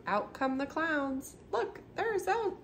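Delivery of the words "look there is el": mock shout. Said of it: "shouts Babar".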